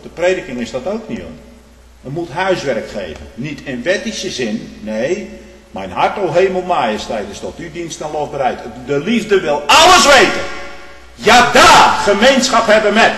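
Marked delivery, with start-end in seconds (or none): 5.7-13.1 preaching at the top of his voice, much higher and louder; fervent shout. Real, not acted. Preacher to his congregation about love for God's word, fervent shout. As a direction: cry it out over the congregation as the sermon peaks.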